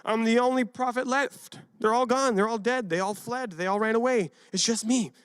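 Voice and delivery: mild mock lament. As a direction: complain you're the last one left. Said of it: "Elisha was saying".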